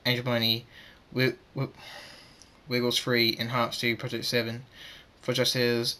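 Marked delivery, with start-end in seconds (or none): none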